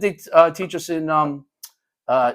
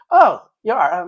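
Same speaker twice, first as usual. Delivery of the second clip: pleasantly taken aback; 7 semitones higher, 5 dB louder, slower than usual. Retold or imitated. Teacher learns she is Justin's mom.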